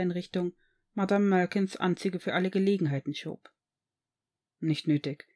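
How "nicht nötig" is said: curt. A narrator's reading. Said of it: "sagte dieser knapp".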